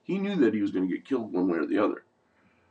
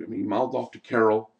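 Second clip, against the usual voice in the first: usual speaking voice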